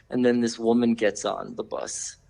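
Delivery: ordinary talk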